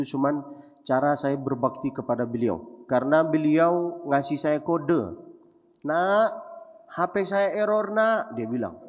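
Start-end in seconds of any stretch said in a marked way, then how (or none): 5.9-8.3 higher, a lightly voiced old father hinting; old father's plea. Father hinting to his son about a new phone, lightly voiced plea. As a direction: coax like an old dad fishing for a gift.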